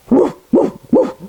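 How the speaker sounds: breathy woof woof; dog barks. Pretend. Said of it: "I'm back to a dog".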